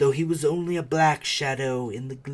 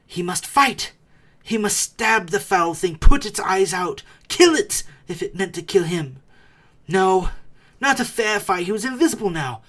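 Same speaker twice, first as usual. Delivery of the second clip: louder, higher, driving; urgent narration; 7 semitones higher, 10 dB louder than usual. A narrator's reading. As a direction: build the fight in him. Bilbo's resolve rising.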